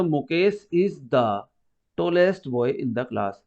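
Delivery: slow, even reading of the example sentence; slow dictation. Shown as a demonstration. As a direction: read the example sentence slowly, word by word. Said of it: "जैसे उसने कहा था". (धीमी आवाज़ में उदाहरण पढ़ना)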